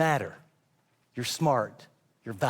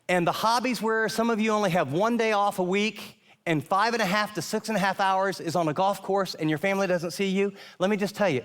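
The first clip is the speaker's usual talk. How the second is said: ordinary talk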